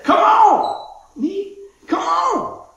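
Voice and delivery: high, loud, stretched call of welcome; a joyful shout of come on; excited call. Acted. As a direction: call out with joy, drawn out. God calling out excitedly.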